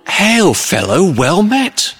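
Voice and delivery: high, drawn-out hearty greeting; hail-fellow greeting. Acted. Late mourner bursting into a funeral, jovially hearty. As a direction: boom out an overjoyed, high-pitched welcome. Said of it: "and it's all".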